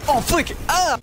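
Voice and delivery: loud, high yelp of dismay; startled shout. Real, not acted. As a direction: cry out in sudden shock. Sudden loss in game.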